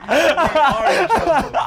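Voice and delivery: high and slow, still laughing hard; squeezing the words out through laughter; second round of laughing at the punchline. Real, not acted. Repeats the line, still cracking up.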